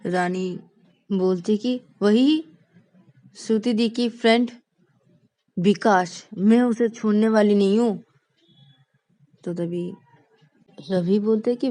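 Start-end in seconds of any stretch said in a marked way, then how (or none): 2.0-8.0 higher, louder furious vow; loud, high petulant rage; angry girl. Acted. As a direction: fume like a wronged younger sister. Rani fuming about Vikas.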